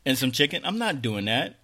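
heated mock tirade at the manager; loud and fed up, swearing at her; retold rant. Customer ranting to the KFC manager on the phone, heated. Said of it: "I was like".

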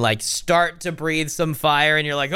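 ordinary talk

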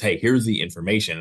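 ordinary delivery

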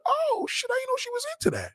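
very high, fast squeaky shock; shocked falsetto. Acted. Guy shocked by what his girl wants, squealing in falsetto.

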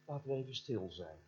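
quiet pastor's call. Retold or imitated. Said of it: "zei de voorganger".

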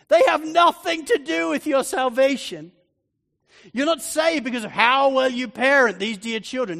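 loud, rising preacher's proclamation; preaching at full force. Acted. Preacher declares grace to the mothers, loud and ringing. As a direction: preach the good news out loud to the mums.

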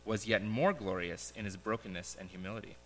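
solemn parable reading with pauses; story narration. Acted. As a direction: read it like a gentle fable to the congregation. Reads a parable aloud.

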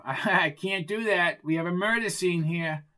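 officious guard character. Acted. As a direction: refuse entry like a self-important guard.

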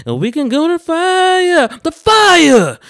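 mock hype shout, very high and slow. Mocking ad-libs over a singer, drawn-out high yelling. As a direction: holler it like an overexcited hype man, stretching each phrase.

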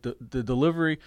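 ordinary talk

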